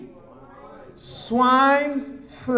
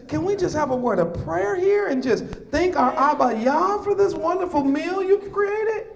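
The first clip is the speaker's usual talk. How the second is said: higher, climbing mock glee into a shout; mock excitement; 6 semitones higher than usual. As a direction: ham up the cheerful believer until you're yelling. Sarcastic mock of lax believers.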